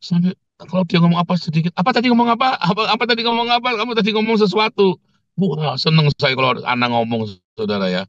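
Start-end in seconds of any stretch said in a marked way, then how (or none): 1.8-5.0 high, insistent mock interrogation; worked-up questioning. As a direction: re-enact pestering someone for an answer. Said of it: "Saya emosi". (menirukan desakan bertanya dengan nada tinggi)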